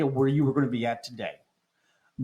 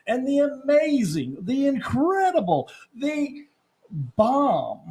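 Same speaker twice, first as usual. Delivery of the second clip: high, loud radio-host build-up; booming morning-show hype; hype announcer intro; 7 semitones higher, 5 dB louder than usual. Acted. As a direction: sell the hosts like a ring announcer.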